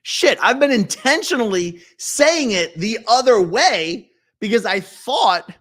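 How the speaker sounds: high, loud, flustered outburst; dismayed realisation. Real, not acted. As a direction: blurt it out in embarrassed shock. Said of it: "realises own mistake".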